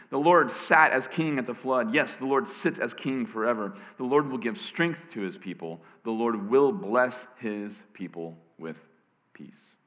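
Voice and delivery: emphatic proclamation settling into a hushed last word; solemn scripture reading. Real, not acted. Preacher closing the psalm, solemn and settling. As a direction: read it weightily, easing down at the close.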